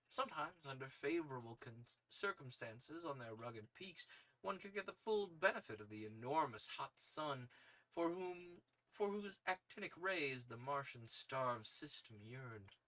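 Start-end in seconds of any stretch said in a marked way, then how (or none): none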